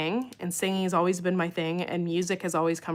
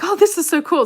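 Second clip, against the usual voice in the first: excited; 11 semitones higher, 10 dB louder than usual. Retold or imitated. Retold excited reaction.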